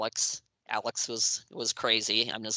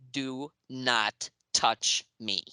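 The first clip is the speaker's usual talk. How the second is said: slow, word-by-word command; firm warning; much slower than usual. Retold or imitated. Writer laying down rules to band before photo, slow firm warning. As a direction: space out every word as a warning.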